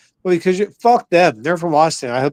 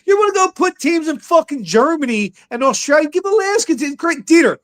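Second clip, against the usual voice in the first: hyped rant; 7 semitones higher than usual. Real, not acted. Ranting hype about Alaska team.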